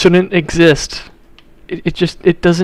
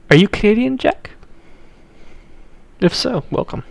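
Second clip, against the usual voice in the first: high teasing question; 7 semitones higher than usual. Real, not acted. Addressing viewer playfully.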